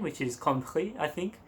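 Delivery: usual speaking voice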